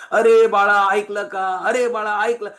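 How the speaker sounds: loud, imitated mother's yell; insistent mock shout from mom; mother calling out. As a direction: call to a child who isn't listening. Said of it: "आई ओरड-, ओरडत राहती".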